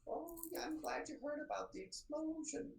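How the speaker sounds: high, prim, scolding librarian voice, softening for Fergus; a fussy older scholar catching intruders; prim older librarian Diana. Acted.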